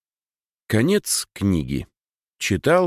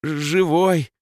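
higher, savoring the word; joyful; 10 semitones higher, 4 dB louder than usual. Acted. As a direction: relish the word with delight. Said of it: "будто пробуя слово на вкус".

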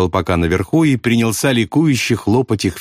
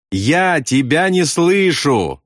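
slow, high shout, word by word; bark; 7 semitones higher, much slower than usual. A narrator's reading. Bombardier snaps at pilot over engines, slow high bark. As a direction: drag each word out in a raised voice. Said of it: "рявкнул Ясариан".

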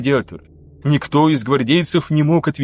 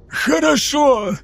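much higher, very slow, hoarse gasp; wheezed; 12 semitones higher, much slower than usual. A narrator's reading. Choking lieutenant finally gives in, wheezing. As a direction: gasp out surrender. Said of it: "прохрипел Паката".

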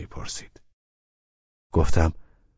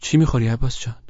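Laleh's voice; 6 semitones higher, 4 dB louder than usual. A narrator's reading. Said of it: "لاله گفت".

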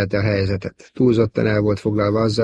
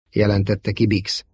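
usual speaking voice

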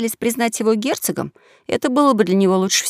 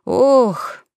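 drawn-out sigh; 4 semitones higher than usual. Acted. Young swindler sighing for his companions to notice, long theatrical sigh. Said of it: "вздохнул, да так протяжно".

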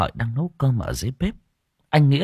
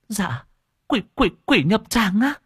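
much higher, stammering terrified question; terrified gasp; 12 semitones higher than usual. Acted. Old woman terrified by the monk's words, much higher voice. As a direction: squeak it out in horror.

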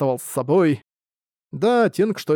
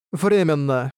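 cold but pleasant voice; slower than usual. A narrator's reading. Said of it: "холодным, но невероятно приятным голосом".

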